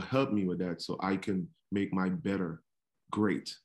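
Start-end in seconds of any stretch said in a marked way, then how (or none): none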